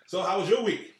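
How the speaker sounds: yelling. Real, not acted. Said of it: "So he's still yelling".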